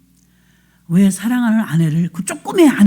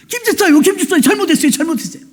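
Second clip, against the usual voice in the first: higher, laughing mock begging; begging husband; 7 semitones higher, faster than usual. Husband begging the deacon for forgiveness, higher, mock grovel. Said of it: "싹싹 빌어".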